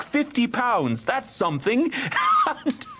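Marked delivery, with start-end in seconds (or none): 2.0-2.6 a quick, bright chuckle; laughing at his own joke; short high laugh. Acted. Nephew amused by his scheme, quick laugh.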